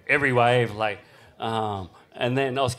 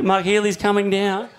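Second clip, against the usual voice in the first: ordinary talk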